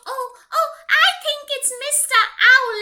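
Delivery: ordinary delivery, nothing marked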